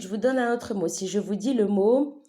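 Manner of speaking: ordinary delivery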